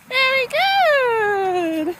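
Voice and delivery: slow high drawn-out praise; talking to a dog. Real, not acted.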